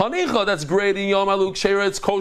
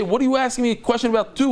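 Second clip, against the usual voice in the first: quick, higher, exasperated question; very mad; 6 semitones higher, faster than usual. Acted. Rabbi challenging the student who asked, very mad. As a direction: fire the question back fast, mock mad. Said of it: "He would be very mad".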